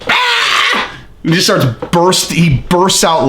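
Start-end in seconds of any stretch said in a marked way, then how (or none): 0.1-1.0 imitating Tito's laugh. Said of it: "just goes".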